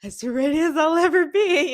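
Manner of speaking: high, loud mock-bold retort; a hammed-up brave face; mock brave reply. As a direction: puff up and declare it half-joking. Nervous reply before speaking.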